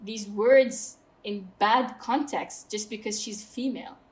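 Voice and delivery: ordinary talk, nothing marked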